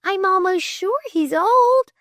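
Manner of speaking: high-pitched bewildered insistence; high astonished protest; shocked gasping. A narrator's reading. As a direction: insist in flustered disbelief. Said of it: "she gasped".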